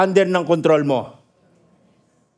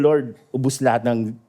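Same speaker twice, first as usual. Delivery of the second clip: much lower, softer despairing prayer; quiet prayer; 8 semitones lower, 3 dB quieter than usual. Retold or imitated. Pastor praying as the fire spreads, quiet despair. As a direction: pray it low and defeated. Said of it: "And I started praying".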